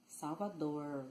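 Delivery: "Salvador" with a curled retroflex final R; full final R. Shown as a demonstration. Teacher contrasting regional versions, "Salvador" with retroflex R. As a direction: hold the curled R at the end of "Salvador", slowly. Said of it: "So instead of saying".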